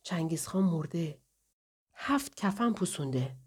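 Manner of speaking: hushed, half-whispered; muffled, soft storyteller's hush. A narrator's reading. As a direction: say it softly, voice held back. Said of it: "با صدای خفه گفت".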